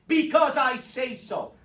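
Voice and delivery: loud, drawn-out parental decree; authoritative grown-up shutting down a question; adult overruling a kid. Acted. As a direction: lay down the law to a child, slow and loud. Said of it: "the adult basically says".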